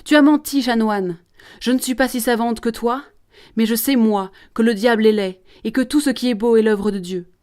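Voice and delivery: crying out in defiance. Acted. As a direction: lash out, then argue back passionately. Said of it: "Elle crie au promoteur". (cri de révolte, puis insistance véhémente)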